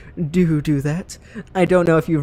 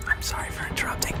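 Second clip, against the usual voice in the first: whisper; 12 dB quieter, faster than usual. Real, not acted. Whispers apology over game dialogue.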